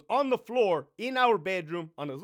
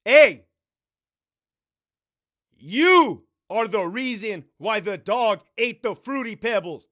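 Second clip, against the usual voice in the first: loud, slow shouted scolding; furious father yelling at his daughter; loud snap; 3 semitones higher, 11 dB louder, slower than usual. Retold or imitated. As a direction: bark it at the child, slow and hard.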